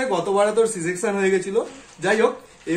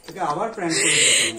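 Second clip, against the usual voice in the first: laughing; 5 semitones lower than usual. Real, not acted. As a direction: laugh through the words. Father laughs after prank.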